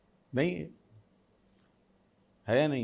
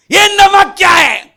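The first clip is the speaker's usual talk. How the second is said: furious shout; 18 dB louder, slower than usual. Acted. Angry man at dinner acted.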